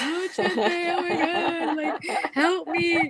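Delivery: hammed-up teary wail to her friend; mock crying plea. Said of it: "I wanted to cry".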